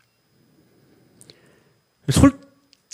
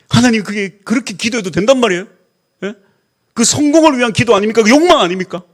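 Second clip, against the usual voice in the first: higher, louder protest to God; indignant complaint; 7 semitones higher, 4 dB louder than usual. Acted. Pastor reenacting his complaint to God, higher and louder. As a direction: throw the grievance upward, aggrieved.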